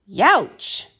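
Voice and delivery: short cry of pain after the kick; pained yelp. Acted. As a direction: yelp as if the hoof stings. After kicking the door.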